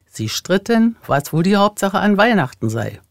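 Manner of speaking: ordinary delivery, nothing marked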